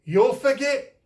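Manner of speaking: high, loud warning call; urgent call after him. A narrator's reading. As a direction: shout it up to him in the sky. Tūhunga calling after flying Rewa.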